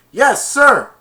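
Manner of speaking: high, loud obedient shout; loud reply. Retold or imitated. Spike answering his dad's order, high loud shout. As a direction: snap back like a soldier.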